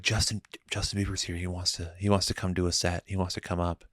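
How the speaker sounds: soft, discreet retold message; quietly relayed heads-up in someone's ear; discreet aside. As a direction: pass the word along under your breath. Retelling a tap-on-shoulder message to DJ.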